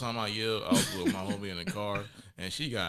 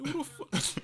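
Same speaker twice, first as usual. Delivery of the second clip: 1 indignant; slower than usual. Retold or imitated. Indignant retort.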